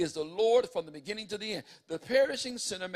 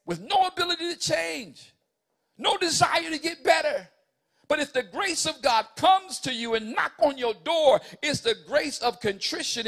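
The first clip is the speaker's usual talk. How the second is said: rising, much louder and higher, driving each phrase home; preaching at full force; 7 semitones higher, 7 dB louder than usual. Acted. Preacher urging the church that grace brings contrition, full force. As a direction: preach it hard to the congregation.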